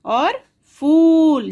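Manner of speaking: teacher stretching फूल to show the long vowel; long u pressed. Shown as a demonstration. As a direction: lean hard on the ऊ in फूल. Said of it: "थोड़ा जोर लगा के बोलना पड़ता".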